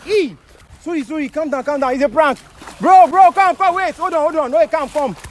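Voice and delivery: high, rushed begging to calm him; panicked pleading. Acted. Begging the fleeing friend to calm down, panicked. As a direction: plead frantically so he stops running. Panicked prankster begs the victim.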